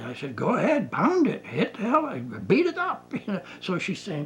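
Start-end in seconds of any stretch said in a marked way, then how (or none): 0.4-3.1 high, climbing encouragement; egging on. Retold or imitated. Therapist urging client to pound the pillow, rising excited pitch. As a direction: urge her on, each phrase pitched higher.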